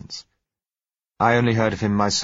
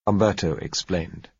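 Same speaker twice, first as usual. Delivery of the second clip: usual speaking voice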